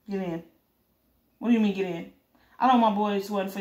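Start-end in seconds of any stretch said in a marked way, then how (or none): none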